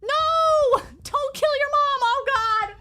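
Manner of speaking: high, loud, panicked shouts; panicked yelling. Real, not acted. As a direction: yell in real panic. Panicking as character kills mom.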